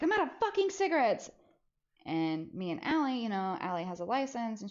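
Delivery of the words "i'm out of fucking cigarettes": much higher, fast complaint; mom's frustration. Retold or imitated. Mom to kids about cigarettes, higher fast complaint. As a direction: complain it in a rush. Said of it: "she's like".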